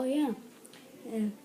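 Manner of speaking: normal talking voice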